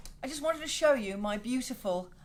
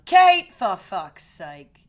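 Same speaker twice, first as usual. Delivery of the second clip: loud drawn-out greeting; 4 semitones lower, 13 dB louder, much slower than usual. Real, not acted. Greeting viewer with mock exasperation.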